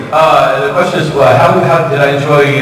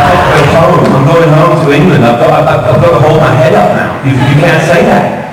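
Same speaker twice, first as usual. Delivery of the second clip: louder mock British rant; Tony's English rant; 3 semitones higher, 4 dB louder than usual. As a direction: ham up an indignant Englishman, louder. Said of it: "he goes".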